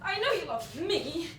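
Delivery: loud, high laughter breaking through the words; mock him with shrill laughter; a teasing rejection laughed out loud; laughing cruelly. Girl taunts the young man.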